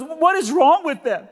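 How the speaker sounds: high, drawn-out exasperated squeal; high-pitched mock distress; emotional cleaning lady. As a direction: imitate a baffled, overwrought lady, slowly. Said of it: "I'm sorry to be emotional".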